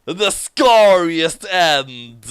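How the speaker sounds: very slow, loud ending line; slow spooky close. Acted. Storyteller announcing the scariest end, very slowly. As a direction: drag out the final words.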